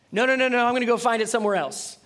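loud, fast, stubborn no; stubborn refusal. Acted. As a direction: brush God off, loud and quick. Runaway person refusing God.

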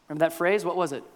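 ordinary delivery

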